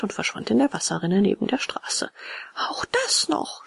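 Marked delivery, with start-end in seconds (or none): 2.5-3.7 high, slow exasperation without loudness; restrained high-pitched cry of anger; angry cry. A narrator's reading. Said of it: "schrie Bengele, immer mehr vom Zorn erfasst".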